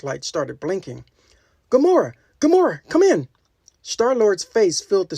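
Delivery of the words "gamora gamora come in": much higher, louder urgent call over the radio. Acted. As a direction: shout her name to get an answer now. Star-Lord calling over comm screen.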